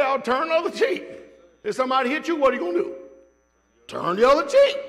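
loud, high, rising mock exasperation; exasperated. Preacher repeating himself to the doubter, exasperated.

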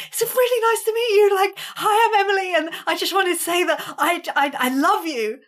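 high, loud starstruck babble; mock an overeager fan introducing herself; puppy-dog gushing. Fan introduces herself to her idol, gushing. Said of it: "I am like this puppy dog".

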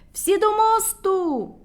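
high, loud, slow rallying call; rally everyone to run; commanding shout. A narrator's reading. Medunytsia shouts for all to run to the bridge. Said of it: "кричала медуниця".

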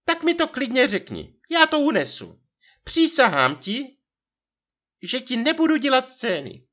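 very high martyred wife voice; ublížená manželka. A narrator's reading. (ublížený vysoký hlas manželky)

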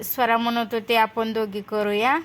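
ordinary talk

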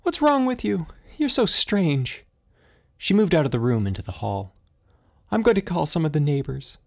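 high-pitched voice of an anxious mother; worried mother. A narrator's reading. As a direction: plead like a frightened mother. Mother confronting the father thing.

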